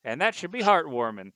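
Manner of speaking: high, loud newsman delivery; reporter voice. Acted. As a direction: spin it like a cheerful newsman. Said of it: "And they're like".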